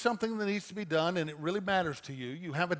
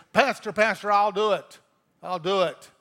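loud, higher pleading cry to be picked; desperate volunteering; 3 semitones higher, 10 dB louder than usual. Retold or imitated. Churchgoer calling out to the pastor, loud and pleading. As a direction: shout out to make the crying stop.

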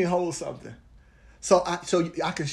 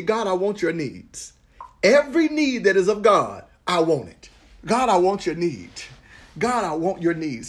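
leading a prayer; 3 semitones higher, 4 dB louder than usual. Acted. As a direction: pray it aloud for the room to follow. Leads congregation in prayer.

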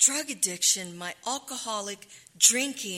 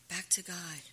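normal talking voice

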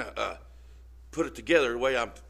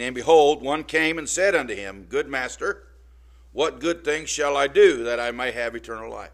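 louder, solemn Bible reading; scripture reading; 7 dB louder than usual. Acted. Preacher reading Matthew to the church, solemn. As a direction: read it out to the flock.

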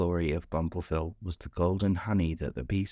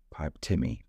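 ordinary delivery

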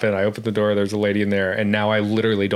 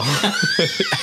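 sudden shrieking burst of real laughter, much higher. Real, not acted. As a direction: explode into a high shriek of laughter.

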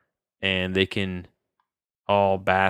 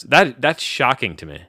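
usual speaking voice

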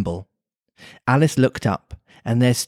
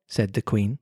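ordinary delivery

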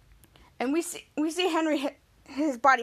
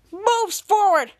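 slow, raised, loud hero line; heroic declaration; 5 semitones higher, 5 dB louder, much slower than usual. Retold or imitated. Captain Man advancing on Dirk, heroic declaration. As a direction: announce it grandly, drawn out.

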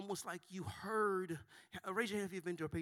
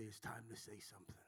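whispered; 9 semitones lower, 14 dB quieter than usual. Shown as a demonstration. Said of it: "Turn to your neighbor and say".

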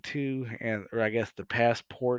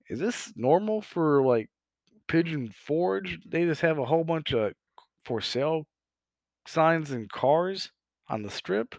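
puzzled; 6 semitones higher than usual. Retold or imitated. Retold own puzzled thought.

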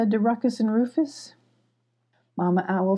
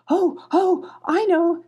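eager; 11 semitones higher, 4 dB louder, slower than usual. Acted. Eager kitten answering.

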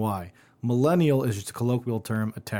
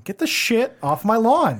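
put-on higher, louder cranky old-man yell at kids; grumpy old man; 9 semitones higher, 4 dB louder than usual. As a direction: shout at kids on your lawn like a crank. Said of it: "we're just grumpy old men now".

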